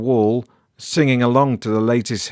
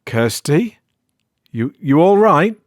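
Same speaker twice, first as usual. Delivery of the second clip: louder, higher anxious call down the stairs; 6 semitones higher, 4 dB louder than usual. Acted. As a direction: call to her, unsure and worried. Calling out in dark house.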